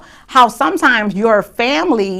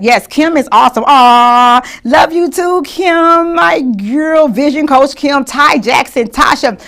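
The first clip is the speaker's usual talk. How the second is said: higher and louder, gushing; light up greeting each friend; host cheering friends joining the room; excited shout-outs; 5 semitones higher, 5 dB louder, slower than usual. Real, not acted.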